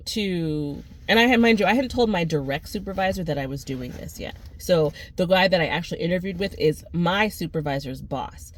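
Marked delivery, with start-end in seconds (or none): none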